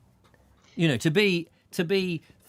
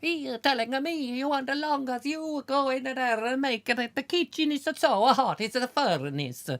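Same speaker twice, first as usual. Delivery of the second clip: mock Italian cook; 10 semitones higher than usual. Italian cook answering back.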